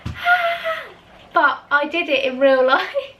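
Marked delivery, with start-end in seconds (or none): 0.1-0.8 high mock scream. Retelling the dream scream, high yelp. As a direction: let out a high short scream. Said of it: "So I actually went".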